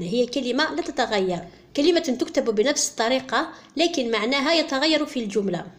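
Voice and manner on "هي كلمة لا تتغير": usual speaking voice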